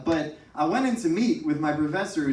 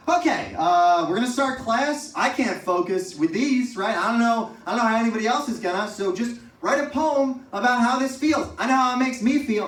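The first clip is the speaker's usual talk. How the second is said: livid professor; 8 semitones higher, 5 dB louder than usual. Retold or imitated. Livid professor over honking trucks.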